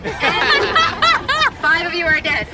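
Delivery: slow words broken by a real laugh. Real, not acted. Guide to tour group, laughing as soldiers fall. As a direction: laugh through the line.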